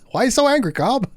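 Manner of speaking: high, fast, laughing mock question; laughing tease. Real, not acted. Streamer teasing the angry captain, laughing through it. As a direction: giggle it out at the grumpy captain.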